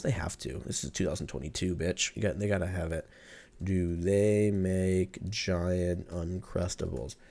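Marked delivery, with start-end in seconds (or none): 3.6-7.2 slow typed search. Real, not acted. Reading a search question aloud to listeners, slowly. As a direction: read slowly as a query. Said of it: "That's why we listen to this".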